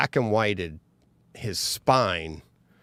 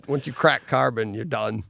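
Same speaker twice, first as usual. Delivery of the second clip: normal talking voice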